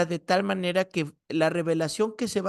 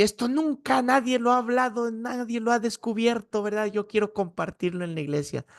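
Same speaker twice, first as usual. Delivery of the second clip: excited; 6 semitones higher than usual. Retold or imitated. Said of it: "Y yo así".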